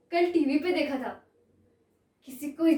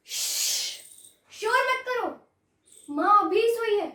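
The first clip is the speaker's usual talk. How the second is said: long hiss, then high, urgent scolding; insistent hushing; 7 semitones higher, 3 dB louder than usual. Acted. Elder sister silencing sister over sleeping mother, insistent hush. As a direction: hush her sharply at raised pitch.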